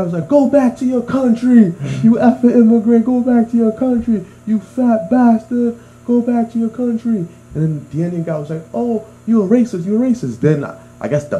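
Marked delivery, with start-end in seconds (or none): 0.3-7.3 high-pitched imitation of an irate driver; retold heckling, pushed up high; mock angry yelling. 8.7-10.4 mock indignant accusation. Retold Indian driver accusing back.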